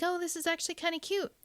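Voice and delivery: a reader charmed against expectations; pleasantly surprised. Retold or imitated. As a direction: reader caught off guard by a cute twist. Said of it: "And I'm like".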